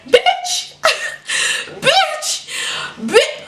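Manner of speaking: very high squeal of disbelief; hammed-up shrieky exclamation; incredulous outburst. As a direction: squeak it out in mock outrage. Outraged reaction to being called disloyal.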